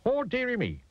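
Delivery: high-pitched sympathetic cooing; kindly tramp. A narrator's reading. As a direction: fuss kindly over a fainted little fellow. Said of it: "he was a kindly tramp".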